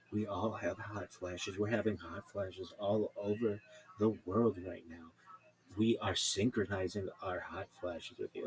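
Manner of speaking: slow, even, unhurried reassurance; calm host. Retold or imitated. Radio host soothing a caller, slow and calm. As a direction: draw it out calmly. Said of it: "Just the calmest way".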